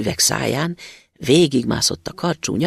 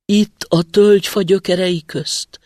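muffled voice from the roots; 5 semitones higher, 6 dB louder than usual. A narrator's reading. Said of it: "felelte a hang".

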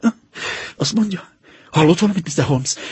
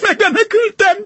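high, overjoyed cries of relief; passionate joy. Acted. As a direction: burst out with wild relief. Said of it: "Öröme épp oly szenvedélyes volt".

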